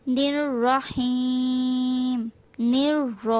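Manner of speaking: র in রহিম said round and full; drawn-out নির রহিম with a heavy, round র; round ra demo. Shown as a demonstration. Teacher shows the rounded র, slow and drawn out. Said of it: "র গোল করে উচ্চারণ করতে হবে".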